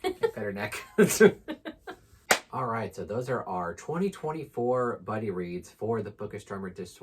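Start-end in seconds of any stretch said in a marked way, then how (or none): 0.0-0.8 high squeak through laughter, breathless; squeaky laugh-voice. Real, not acted. Reacting to the annoyed cat, high squeaky laugh-voice. 0.8-2.5 high loud burst of laughter. Real, not acted. Laughing at the cat after petting her, loud high laugh.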